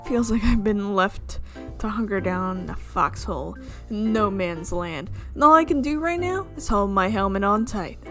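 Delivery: ordinary delivery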